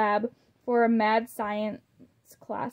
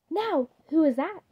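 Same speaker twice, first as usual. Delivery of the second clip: wondering aloud; 5 semitones higher than usual. A narrator's reading. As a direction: ask who's at the door, puzzled. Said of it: "Dracula yelled".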